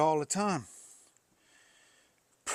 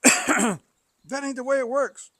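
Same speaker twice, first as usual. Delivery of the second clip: much higher, loud mock worker; worker's refusal; 12 semitones higher, 11 dB louder than usual. Landfill worker refusing the oil, loud mocking voice. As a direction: throw it back like the stubborn worker.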